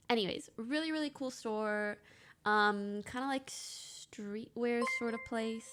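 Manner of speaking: usual speaking voice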